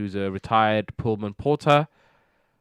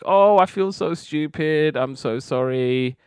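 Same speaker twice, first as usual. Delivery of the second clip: higher, slowed, put-on sorry voice; hammed-up apology, higher and slower; mock apology; 5 semitones higher than usual. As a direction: play the maid pretending to be sorry, drawn out. Maid apologizing to employers.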